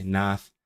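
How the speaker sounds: NATH with a plain "ah" in place of "eye"; Texan-feeling Welsh vowel. Shown as a demonstration. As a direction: say "Nath" in the regional Welsh way, open "a". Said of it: "it feels Texan in some way".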